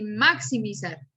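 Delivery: "maximizar" stressed on the first syllable, MAX; misplaced stress. Shown as a demonstration. Teacher showing how we don't say it, "maximizar" stressed on MAX. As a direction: punch MAX at the start of "maximizar". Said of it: "yo no digo".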